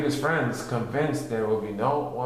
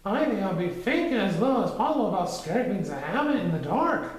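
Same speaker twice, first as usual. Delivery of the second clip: high, fast, nervous squeak; nervous Piglet; 8 semitones higher than usual. A narrator's reading. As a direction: hurry through it anxiously. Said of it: "trying to keep his ears from trembling".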